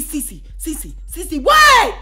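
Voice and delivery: imitating the coworker calling her; mimicking Oz. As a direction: call her name then shout what.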